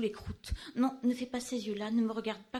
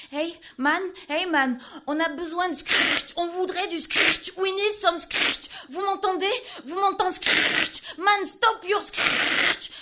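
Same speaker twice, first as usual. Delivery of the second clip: raised, higher calling voice, half in English; shouting out; 6 semitones higher, 6 dB louder than usual. Acted. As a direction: call out desperately for scratch. Calling out for scratch.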